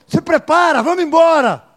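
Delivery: loud, high call to get ready; angel urging. Acted. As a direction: rouse them like an angel sent to fetch them. O anjo falou.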